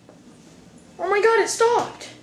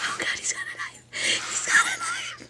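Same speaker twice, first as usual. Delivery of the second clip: panicked whisper. Acted. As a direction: squeak it out in terror.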